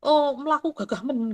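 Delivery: a hint of a teasing bystander; mocking onlooker. Bystander mocking a woman who walks briskly, light jeer. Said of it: "Contohnya apa ya tadi".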